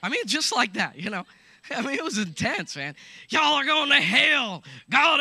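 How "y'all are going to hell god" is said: loud, high mock preacher yell; imitating an angry preacher shouting; hellfire preacher. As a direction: hammer it like a brimstone preacher. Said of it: "hellfire and brimstone preachers".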